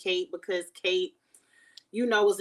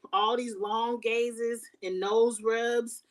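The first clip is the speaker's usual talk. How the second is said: slow drawn-out mock; 2 semitones higher, much slower than usual. Running joke on nose rubbing.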